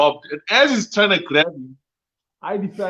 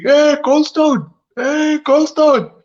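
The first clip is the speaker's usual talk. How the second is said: high, long-drawn call, louder; imitated screaming; 12 semitones higher, 5 dB louder, much slower than usual. Inmate hailing him across the prison, imitated screaming. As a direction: holler it across the prison, stretching each word. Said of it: "there's a old screaming".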